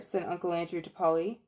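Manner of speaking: plain reading voice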